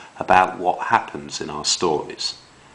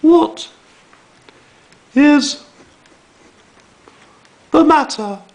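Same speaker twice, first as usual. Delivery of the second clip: much higher, louder, slow concerned mum; 8 dB louder than usual. Shown as a demonstration. Mum asking upset Bear what's wrong, high concerned voice. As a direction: ask it like a worried mother. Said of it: "his mum would say".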